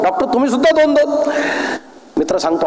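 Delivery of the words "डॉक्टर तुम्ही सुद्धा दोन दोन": high-pitched mock yell; retold cry. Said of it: "हा ओरडतो".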